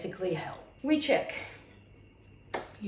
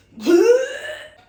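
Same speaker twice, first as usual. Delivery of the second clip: loud high laugh; 16 dB louder than usual. Real, not acted. Laughs at her dry retching.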